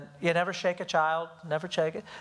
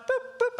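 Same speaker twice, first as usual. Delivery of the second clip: two high, light boops; high-pitched toy-like tap noises; imitated little smacks. Dad shows how gently he spanked his son, high boops.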